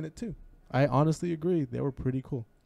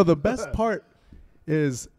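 ordinary delivery

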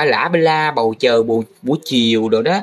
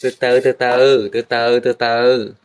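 slow peering hold on; 2 semitones lower, slower than usual. Real, not acted. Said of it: "Tôi phải soi cho kỹ".

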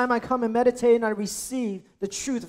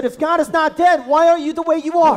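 loud, raised-pitch rebuke; wife rebuking Martin Luther, raised and loud; wife's rebuke; 5 semitones higher, 7 dB louder than usual. Retold or imitated. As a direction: challenge him hard.

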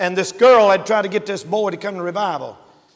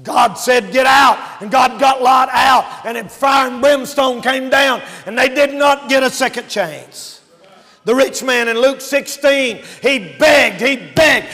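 raised, driving sermon voice; forceful preaching; 7 semitones higher, 9 dB louder than usual. Real, not acted. Preacher telling of fire and brimstone, preaching forcefully. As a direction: preach it out strongly.

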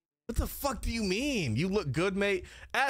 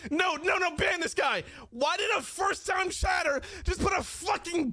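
furious at a troll, wants him out; outraged yelling; 6 semitones higher than usual. Real, not acted. Streamer yelling at his moderator to ban a chatter.

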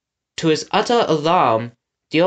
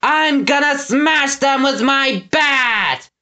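high, slow brute's roar; bellowed threat; 12 semitones higher, 3 dB louder, slower than usual. A narrator's reading. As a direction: roar it out word by word. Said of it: "bellowed Gristle".

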